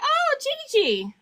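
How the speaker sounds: put-on high, sweet little boy voice; toddler grandson voice. Grandson greeting grandma on FaceTime, high toddler voice. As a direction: coo it like a two-year-old.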